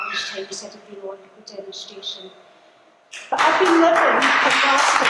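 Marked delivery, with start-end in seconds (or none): 3.3-5.1 loud rallying cry. Real, not acted. Rallying line to the crowd.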